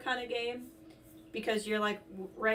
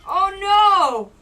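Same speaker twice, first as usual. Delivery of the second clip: high, loud alarmed cry; panicked shout; 9 semitones higher, 13 dB louder, much slower than usual. Real, not acted. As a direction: yelp it in panic. Panic under cannon fire.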